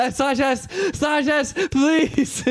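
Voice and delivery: high, laughing begging; laughing plea. Real, not acted. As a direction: beg through laughter.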